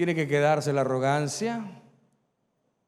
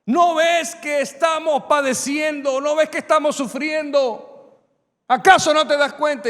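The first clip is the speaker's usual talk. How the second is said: reproaching; 9 semitones higher, 8 dB louder than usual. Acted. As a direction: drag it out like a desperate complaint. Acted reproach to God.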